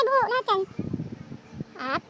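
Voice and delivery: usual speaking voice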